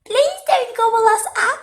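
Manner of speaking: very high, loud frightened pigs; pleading squeal. A narrator's reading. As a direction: beg for your life in a squeaky squeal. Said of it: "squealed the three little pigs".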